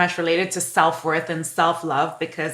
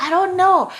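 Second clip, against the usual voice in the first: hesitant self-quote; 12 semitones higher, 4 dB louder than usual. Retold or imitated. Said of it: "But I was like".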